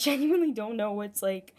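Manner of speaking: ordinary delivery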